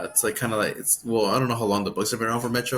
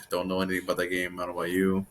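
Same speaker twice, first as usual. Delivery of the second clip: normal talking voice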